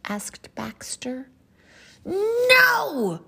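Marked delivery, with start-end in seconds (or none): 2.0-3.2 high, loud cartoon shout; exasperated storybook outburst. A narrator's reading. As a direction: shout a cranky refusal at full volume. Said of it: "shouted Pom Pom".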